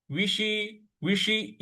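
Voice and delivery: विषी with the tongue curled back to the roof for ष; retroflex ष. Shown as a demonstration. Teacher shows the tongue position for ष in विषी. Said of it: "जीभ को थोड़ा सा मूर्ध्वा पे लगाएंगे".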